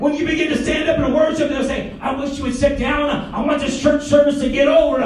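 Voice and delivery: loud, high, shouting sermon delivery; full-force preaching. Acted. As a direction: preach it hard to the congregation.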